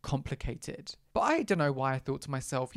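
ordinary talk

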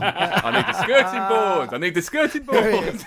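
put-on Israeli accent. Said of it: "stereotypical Israeli voice".

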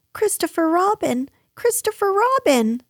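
very high, urgent little cry; Piglet crying out. A narrator's reading. Piglet sees Christopher Robin come in, crying out. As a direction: call for rescue, pitched right up. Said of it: "cried Piglet".

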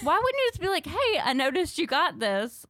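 high, loud protest; exasperated mock question. Daughter questioning mom's silence, exasperated mock question. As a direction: push it up high in mock disbelief.

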